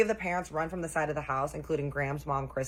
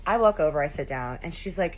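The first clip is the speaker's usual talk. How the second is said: ordinary talk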